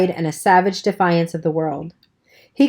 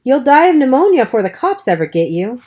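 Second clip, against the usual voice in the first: higher, louder exasperated scolding; 6 semitones higher, 4 dB louder than usual. Acted. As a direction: tell him off in alarm. Dally scolding wet boy.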